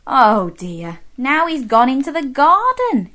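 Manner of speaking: ordinary delivery, nothing marked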